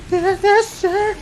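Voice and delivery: put-on high, timid little kid asking for candy. Scared kid asking grumpy dad for candy, high and timid. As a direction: squeak it out like a nervous small child.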